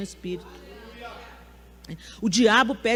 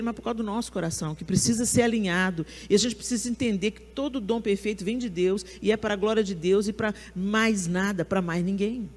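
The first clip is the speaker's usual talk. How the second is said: ordinary talk, nothing marked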